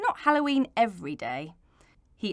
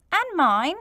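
put-on high, louder childish whine; whining; 7 semitones higher, 7 dB louder than usual. A narrator's reading. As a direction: whine like a wronged kid. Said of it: "whined Hazy".

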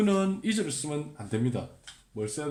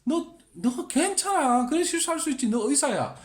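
comforting friend; 10 semitones higher, 3 dB louder than usual. Pretend. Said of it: "comforting colleague".

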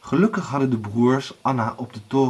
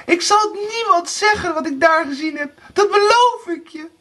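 very high, panicked pleading; frightened screaming; 4 dB louder than usual. A narrator's reading. Erika begs Blauwbaard for her life, screaming in fear. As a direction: shriek your desperate promise. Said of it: "schreeuwde angstig".

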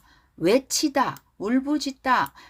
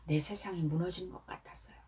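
hushed, whispered despair; whispered heartbreak; 3 semitones lower, 12 dB quieter, faster than usual. Acted. Teacher whispering the heartbroken film character's line. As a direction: whisper it like the world just ended.

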